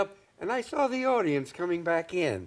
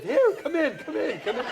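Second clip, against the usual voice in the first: high, giggling comic welcome; much higher, a chuckling old comic inviting him in; mock Ed Wynn ushering a kid backstage; imitating Ed Wynn; 10 semitones higher than usual. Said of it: "Is that how he talks?".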